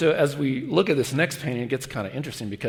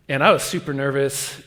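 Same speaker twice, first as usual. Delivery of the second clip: normal talking voice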